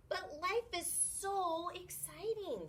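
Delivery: high, slow and wide-eyed; lightly voiced young shark marvelling; dreamy excitement. Clark excited about life.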